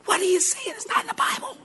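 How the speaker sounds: high, soft, hushed panic; frantic whisper. Retold or imitated. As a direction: whisper urgently to the wife across the church. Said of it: "I'm like".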